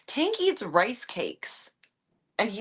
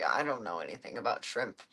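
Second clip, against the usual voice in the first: low, mock-puzzled dad voice; dad shrugging over shrimp, lowered voice; imitating dad; 8 semitones lower, 4 dB quieter than usual. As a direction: lightly play the clueless dad at the store. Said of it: "he's like".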